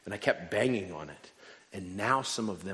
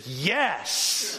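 high, loud imitation of an exasperated congregation; one emphatic, high outburst; crowd's shouted answer; 9 semitones higher, 6 dB louder than usual. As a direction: shout it back as the whole church. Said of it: "And the whole church said".

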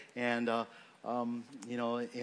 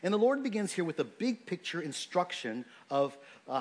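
usual speaking voice

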